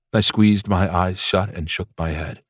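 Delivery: plain reading voice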